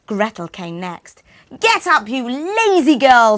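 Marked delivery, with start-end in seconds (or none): 1.6-3.4 loud, higher screeching order; witch screech. Acted. The witch rouses Gretel with a loud high screech. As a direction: screech the girl awake. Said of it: "screeched the witch".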